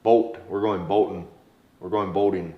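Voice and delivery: North Dakota vowels, a little low; North Dakota accent. Shown as a demonstration. Coach recalling words dates found funny, North Dakota accent. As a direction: lean into North Dakota "boat". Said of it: "You say no funny".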